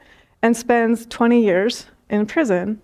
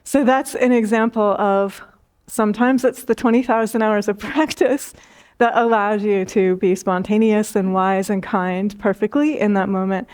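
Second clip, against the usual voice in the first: usual speaking voice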